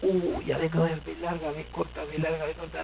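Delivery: very soft, murmured dithering; muttered wondering. Acted. Writer dithering over B larga or B corta, muttered. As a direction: mull it over under your breath. Acting out doubt over spelling.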